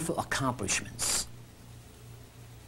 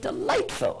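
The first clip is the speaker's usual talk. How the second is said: quoting a lady friend. Retold or imitated. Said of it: "and she said".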